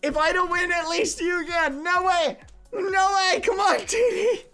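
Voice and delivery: high, loud shrieks of panic and hope; real frantic cheering; frantic screaming. Real, not acted. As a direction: scream for your racer to make it. Frantic cheering at race finish.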